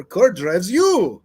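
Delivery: slow hammed-up Russian accent. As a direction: land the punchline in a heavy Russian accent. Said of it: "my Russian accent starts surfacing again".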